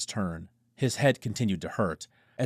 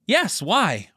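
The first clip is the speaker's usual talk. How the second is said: containing excitement; 11 semitones higher, 7 dB louder than usual. Acted. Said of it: "trying to contain his emotion".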